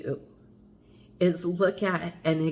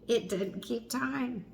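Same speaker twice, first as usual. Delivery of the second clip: quiet laugh; 6 semitones higher, 6 dB quieter than usual. Real, not acted.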